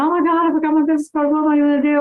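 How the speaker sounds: retold panic. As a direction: blurt it out in a hammed-up panic.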